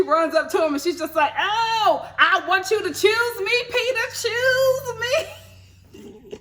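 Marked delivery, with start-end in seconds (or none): 1.4-6.4 high-pitched, hammed-up begging; imitated fan plea. Fan runs up to Peter at the airport, hammed-up pleading. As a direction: plead in mock desperation, stretching the last words.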